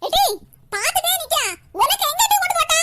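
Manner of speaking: high, loud staged scolding; mock furious yell. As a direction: bark it like a tyrant mother-in-law. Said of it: "எல்லாம் நடிப்பு". (நடிப்புக் கோபத்தில் உரத்த அதட்டல்)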